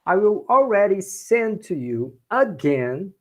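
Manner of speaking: usual speaking voice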